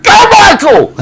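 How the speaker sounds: very high, loud cheer from the back of the line; high-pitched, full-volume holler imitating a heckling fan; imitated yell. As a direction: yell it out like an old woman cheering someone on. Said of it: "an old woman at the back of the line yelled out".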